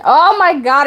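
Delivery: ordinary delivery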